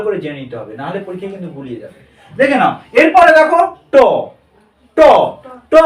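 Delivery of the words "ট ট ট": ট with the tongue curled up to the roof of the mouth; retroflex ট. Shown as a demonstration. Teacher shows the tongue curling, retroflex ট. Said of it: "জিভ বেঁকে উপরে উঠছে".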